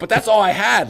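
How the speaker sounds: high, loud mock whine; whiny complaint. Hunter retelling losing his food, in a high mock whine. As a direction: plead like a kid who lost his lunch.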